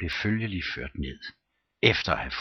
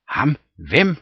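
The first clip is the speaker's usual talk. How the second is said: louder, higher startled ask; startled question; 6 semitones higher, 6 dB louder than usual. A narrator's reading. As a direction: demand who, startled. All ask at once. (forbløffet spørgsmål)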